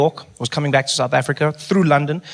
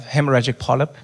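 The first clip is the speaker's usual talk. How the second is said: ordinary talk, nothing marked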